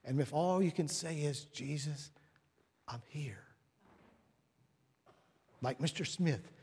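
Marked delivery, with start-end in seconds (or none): 1.5-3.4 soft, hushed address to Jesus; quiet private prayer. Acted. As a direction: pray it under your breath. A brief private prayer, acted.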